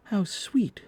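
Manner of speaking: put-on high delighted girl's cry. A narrator's reading. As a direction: gush with delight. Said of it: "cried Wendy".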